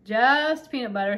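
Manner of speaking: loud, slow, final statement; emphatic, settling the matter; firm. A narrator's reading. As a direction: lean hard on "Just", drawn out. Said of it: "said the king firmly".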